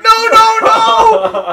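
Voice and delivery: frantic high shout of no; panicked parents yelling. Pretend. Parents yelling at their baby, frantic shout. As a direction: parents screaming to stop the baby. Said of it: "the parents are like".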